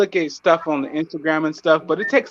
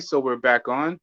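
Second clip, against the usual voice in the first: ordinary delivery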